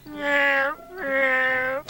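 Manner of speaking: drawn cat meows from afar; cat heard through the house walls; cat yowling. Retold or imitated. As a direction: yowl like a horny cat. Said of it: "hear this goddamn cat".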